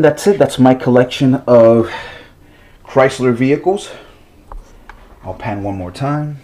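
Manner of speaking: ordinary delivery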